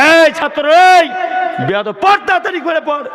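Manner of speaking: high, loud scolding teacher; teacher shouting. Retold or imitated. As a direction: bark at the student to read. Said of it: "কোন master পড়ায় দেখেছেন?".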